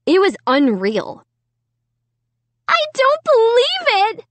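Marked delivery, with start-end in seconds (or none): 2.7-4.3 high piercing shriek. A narrator's reading. Sarah shrieking at her ruined carpet, high shriek. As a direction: shriek it in disbelief. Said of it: "Sarah kept shrieking".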